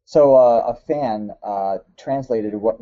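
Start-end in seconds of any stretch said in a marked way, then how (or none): none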